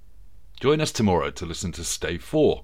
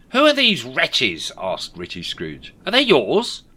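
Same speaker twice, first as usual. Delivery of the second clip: slow, raised character voice; Scrooge voice; 4 semitones higher, 6 dB louder than usual. A narrator's reading. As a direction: ask it slowly, worried.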